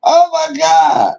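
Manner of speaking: slow, high, breathless with laughter; laughing. Real, not acted. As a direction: stretch it out, still laughing. Still laughing.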